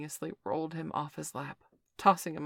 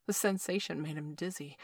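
ordinary delivery, nothing marked

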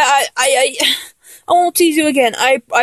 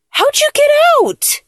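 much higher, startled and disbelieving; shocked question; 12 semitones higher than usual. Acted. Finding the partner free of the ties, shocked. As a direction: ask it as if caught off guard.

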